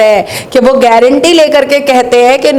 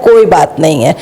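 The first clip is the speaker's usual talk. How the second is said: sarcastic dismissal; 8 semitones lower than usual. Retold or imitated. Sarcastic refrain after each charge.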